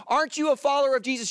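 quick, high-pitched challenge, lightly acted; lightly voiced woman asking, high and fast; accusing questioner. Woman asks Peter if he follows Jesus, high accusing question. Girl confronting Peter in story.

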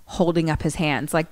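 usual speaking voice

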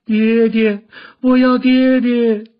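high, loud, drawn-out wail; wailing cry. A narrator's reading. Daughter wakes to news of father's death, wailing aloud. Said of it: "黄蓉只是哭叫".

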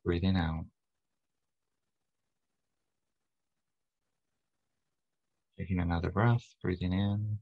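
calm, unhurried meditation guide; guided meditation. Acted. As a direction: lead them into relaxation, leaving long pauses between phrases. Leads guided breathing and relaxation meditation.

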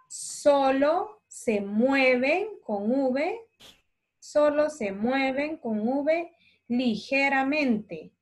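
drawn-out dictation, phrase by phrase; slow dictation. Shown as a demonstration. Teacher dictating slowly to children copying notes. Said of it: "Les puedo ir leyendo despacito".

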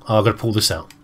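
usual speaking voice

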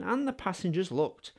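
ordinary delivery

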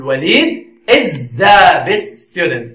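slow answer with "the" drawn out; completed answer. Shown as a demonstration. Teacher filling the gap for learners, slow with drawn-out "the". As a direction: stretch "the" as the missing word. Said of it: "فنقول".

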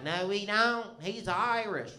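high, loud, indignant mom denying it; mock drunk mom. Comic telling his audience what the drunk mom insisted, high and loud. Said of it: "She's like".

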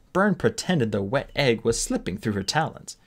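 ordinary delivery

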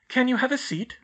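an eager schoolgirl urging friends on; Hermione, brisk. A narrator's reading.

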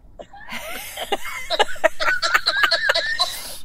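high laugh. Real, not acted. Friend reacts to hug story.

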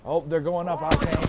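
urgent callout. Real, not acted. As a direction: call it out to your teammate. Alerting teammate in combat.

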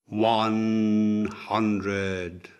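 sustained, intoned number; slow countdown. A narrator's reading. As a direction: stretch each word like a solemn toll. Physician counts down to death.

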